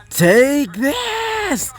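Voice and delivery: very high, held-out mock scream; screamed battle cry. A narrator's reading. Kirishima launches himself at Dogen, restrained scream. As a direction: stretch it into a high battle yell, volume held back. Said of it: "as he would scream out".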